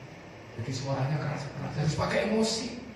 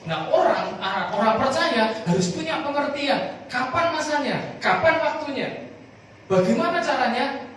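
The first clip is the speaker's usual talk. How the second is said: high, loud, driving preacher's voice; fervent preaching; 13 semitones higher, 5 dB louder than usual. Acted. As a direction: preach it to the congregation with fire. Preaching at full force. (berkhotbah dengan berapi-api)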